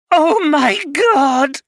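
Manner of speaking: wife crying out at the news; anguished cry. Acted. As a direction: break down in dismay. Hears loss, starts crying.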